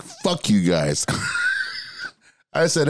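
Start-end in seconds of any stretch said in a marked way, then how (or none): 0.0-2.5 slow, laughing fake insult; laughing mock curse. Retelling the band's teasing, laughing. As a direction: swear at them through a laugh. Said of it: "And I was like".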